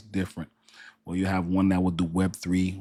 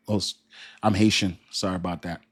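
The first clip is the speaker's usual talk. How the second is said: normal talking voice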